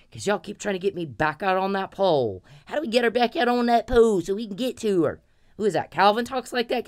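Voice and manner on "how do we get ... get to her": higher, put-on voice of a scheming guy; mock scheming man. Mocking the men who plot against her, a higher put-on voice. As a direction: sneer it like the plotting men.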